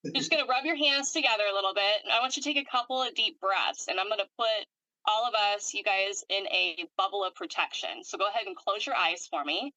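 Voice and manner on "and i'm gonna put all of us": ordinary delivery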